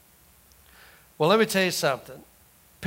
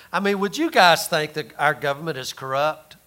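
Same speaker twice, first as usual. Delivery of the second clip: usual speaking voice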